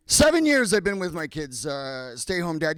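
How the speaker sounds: ordinary talk, nothing marked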